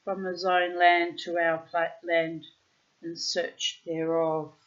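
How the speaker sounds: put-on loud, deliberate read-aloud of an old tale; storybook reading. A narrator's reading. Reading an old tale aloud to the bird, deliberate storyteller voice. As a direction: read it out like an Arabian Nights storyteller.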